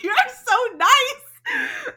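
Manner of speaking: very high, loud and slow, stretched out gushing; gushing fangirl squeal. Retold or imitated. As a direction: melt into it like a starstruck fan. Gushing at her favorite narrator.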